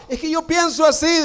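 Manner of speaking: high, smug mimicry of someone trusting their own opinion; mocking a self-sure believer. Preacher mocking a churchgoer who follows his own thinking, high and smug. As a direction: pitch it way up and sound self-satisfied.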